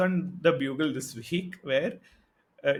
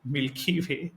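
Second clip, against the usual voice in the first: normal talking voice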